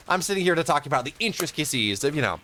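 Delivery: normal talking voice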